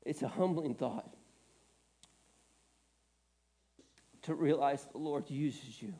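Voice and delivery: low, soft, choked-up voice; tearful. Real, not acted. Preacher emotional about his calling, quiet and tearful. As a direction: speak softly through tears, humbled. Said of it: "I'm gonna have to blow my nose".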